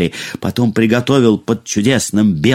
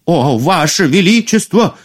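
higher-pitched cry from the cook; 7 semitones higher than usual. A narrator's reading. Cook answering the queen's monstrous order, crying out. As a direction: exclaim to the queen in a raised pitch. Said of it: "вскрикнул повар".

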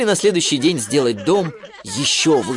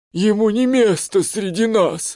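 snooty elephant; 7 semitones higher, slower than usual. Acted. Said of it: "поддакнул второй".

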